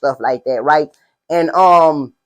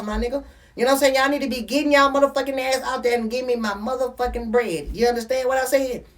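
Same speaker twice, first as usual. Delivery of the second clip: scolding the house; 5 semitones higher, 7 dB quieter than usual. Real, not acted. Dad telling the kids to hush during his interview, bossy raised call. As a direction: shut them up so the interview can go on.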